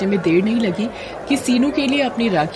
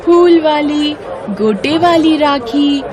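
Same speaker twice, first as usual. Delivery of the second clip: high, loud street-seller call; 8 semitones higher, 4 dB louder, slower than usual. A narrator's reading. Girl hawking rakhis in the market, loud high calls. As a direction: cry out the rakhi wares to passersby. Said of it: "जोर से चिल्लाती है".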